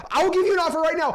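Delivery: playfully over-eager buyer voice; mock eager reply. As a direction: blurt it out to calm the yelling seller. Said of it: "what I always like to say is".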